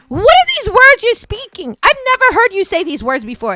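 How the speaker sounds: high, loud disbelief; mock astonishment. Wife hearing husband use drag slang, loudly incredulous. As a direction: question him in exaggerated amazement.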